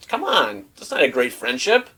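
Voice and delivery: ordinary talk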